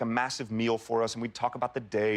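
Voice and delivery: ordinary delivery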